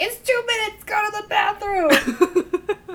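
high, loud, frantic urging; urgent mock yell. Imitating a friend urging her to sneak off, urgent yell. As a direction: yell it shrilly like an impatient fangirl.

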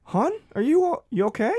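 very high, concerned husband voice; worried Gary. Acted. Gary checking on his wife Dolores, very high and worried. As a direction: fret over her gently.

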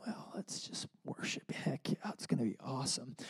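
breathy whisper of forced cheer; whispered fake enthusiasm. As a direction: whisper it, pretending to be excited. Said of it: "I kinda walked in like".

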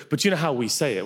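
ordinary talk, nothing marked

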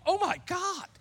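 sudden high gasped oh my God; shocked high exclamation; panicked realization. Retold or imitated. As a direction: jolt up into a shocked squeak. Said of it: "and then I'm like".

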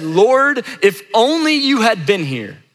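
pleading cry that starts high and falls; emotional, not calm. Acted. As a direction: act out a grieving sister's reproach. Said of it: "said this with a lot of emotion".

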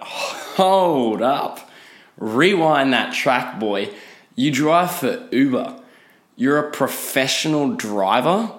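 slow, drawn-out incredulous challenge; mock disbelief. Passenger questioning an Uber driver's boast, slow incredulous disbelief. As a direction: stretch it out, ending in a rising question. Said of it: "And I thought".

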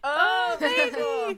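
high, loud squeal of adoration; gushing coo. Real, not acted. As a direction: coo over an adorable pet. Cooing at the cat prop.

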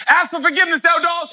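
dad calling his son to apologise. Retold or imitated. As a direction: call him to apologise. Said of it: "I'd say".

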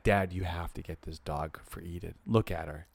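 lightly voiced teen boy urging Dad; imitating son. Father voices his son pressing him for the dog, low and soft. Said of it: "Elijah's like".

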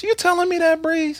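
very high squeaky disbelief; mock shock. Teasing shock at friend.

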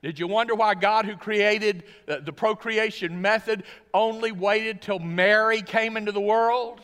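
loud, high, ringing pulpit voice; booming sermon delivery building to a climax; full-force preaching. Acted. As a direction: preach it out to the back row.

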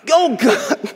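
ordinary talk, nothing marked

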